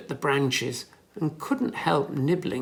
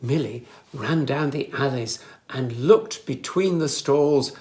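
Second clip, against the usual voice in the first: ordinary delivery, nothing marked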